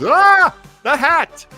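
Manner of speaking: normal talking voice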